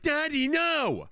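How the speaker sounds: put-on high, slow child's wail; high drawn-out little girl cry; daughter's whine. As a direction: squeal it like a little girl begging. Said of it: "And Eleanor's like".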